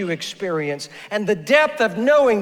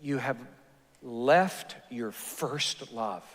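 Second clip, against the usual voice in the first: soft and slow, lower; tender; 7 semitones lower, 9 dB quieter, slower than usual. Retold or imitated. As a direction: let the rebuke land gently and slowly. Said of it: "he says so tenderly".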